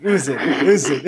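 laughing through the words. Real, not acted. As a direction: burst out laughing. Laughing at marriage news.

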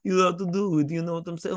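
put-on failed stab at an Italian accent; hammed-up, off-target Italian; bad Italian accent. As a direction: fumble through a mock Italian accent. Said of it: "I don't know how to do an Italian".

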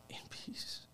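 whispered, barely voiced; hushed reverence. Son revealing what his late father meant, hushed whisper. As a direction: let the words drop to a whisper.

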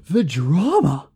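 slow, hammed-up gasp of the drama; slow faux-scandalised aside; mock drama. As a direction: play it like a gossip show. Mock gasp at couple's breakup.

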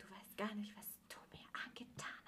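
hushed menacing whisper; threatening voice. Retold or imitated. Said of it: "In so einer richtigen Drohstimme".

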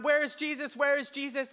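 mock panic. Disciples searching for Jesus in the storm, mock panic. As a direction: call out as if mildly panicking. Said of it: "They're screaming".

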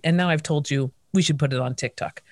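normal talking voice

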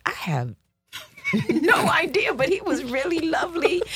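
laughing high, giddy with disbelief. Retold or imitated. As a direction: giggle through it. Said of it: "I was like".

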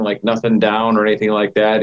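normal talking voice

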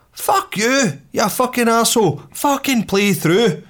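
very high-pitched indignant swearing; retold angry outburst. Two golfers swear at the group behind, retold angry outburst. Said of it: "two of us in unison went".

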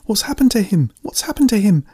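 high-pitched, frantic cry; desperate, high cry to rouse someone; panicked cry. A narrator's reading. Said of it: "Amanda cried as she tried to shake".

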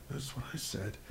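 whispered, barely audible mutter; under his breath. Retold or imitated. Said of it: "say under your breath".

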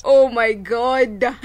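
breathless laughing oh my god, drawn out and loud. Real, not acted. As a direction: laugh it out, overjoyed. Gushing about a great week.

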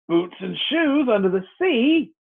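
plain reading voice

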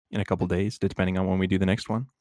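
ordinary talk, nothing marked

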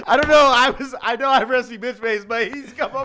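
high-pitched laughing through the words; can barely get it out for laughing; laughing hard. Real, not acted.

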